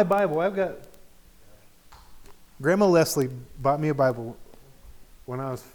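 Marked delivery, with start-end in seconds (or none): none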